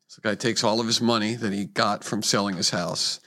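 ordinary talk, nothing marked

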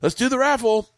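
high-pitched retold rallying cry; mock enthusiasm. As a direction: announce it with put-on eagerness. Giving in to the eager crowd.

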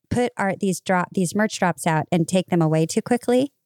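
ordinary talk

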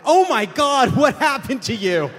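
high, loud alarmed cry; shouting in shock. Retold or imitated. Friend shouting at the speaker's welted body, high and alarmed. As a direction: yell it like you just saw something awful. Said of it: "Ronan shouted".

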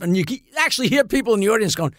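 ordinary talk, nothing marked

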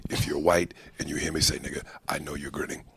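low, soft comedian impression; Paul Mooney. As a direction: keep it quiet and low, slyly deliberate. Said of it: "Your random Paul Mooney impressions".

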